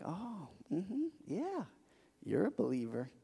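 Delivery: soft knowing murmur to himself; quietly spotting a fellow believer; knowing aside. Retold or imitated. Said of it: "I'm like".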